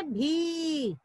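भी with a long, sustained ee; long ee sound. Shown as a demonstration. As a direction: stretch the vowel in भी. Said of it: "और उसका उच्चारण करेंगे". (भी को लंबी ई से खींचकर)

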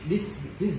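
DISH imitated with the wrong ending; misheard ending. Teacher echoes what he misheard, DISH with a wrong ending. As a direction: echo a student's botched DISH, questioning it. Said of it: "thầy nghe thành".

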